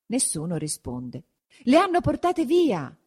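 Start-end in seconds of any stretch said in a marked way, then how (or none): 1.6-2.9 high-pitched exclamation of alarm; alarmed cry. A narrator's reading. Villagers finding the women gone, high alarmed cry. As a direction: cry out the discovery, pitch leaping up.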